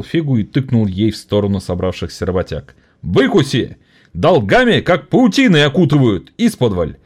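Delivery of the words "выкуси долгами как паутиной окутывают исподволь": rousing, a little higher; orator jeering. Acted. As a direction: spit out the grievance. (оратор гневно)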